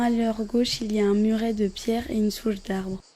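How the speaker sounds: ordinary delivery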